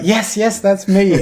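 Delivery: high, loud eager yes; relieved answer. Retold or imitated. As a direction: blurt it out with relief. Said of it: "And I was like".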